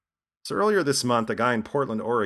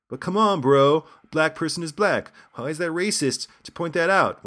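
mock dismissive bro; 7 semitones higher, 6 dB louder than usual. Host voicing an imagined objector, in a mock bro voice. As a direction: play the clueless guy who thinks he has a point.

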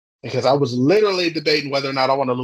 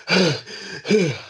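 deliberate, exaggerated huff of breath; heavy purposeful breathing; 5 semitones higher than usual. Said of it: "heavily breathing air in the area".

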